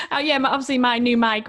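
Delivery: laughing at losing count of episodes. Real, not acted. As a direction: giggle at her own slip.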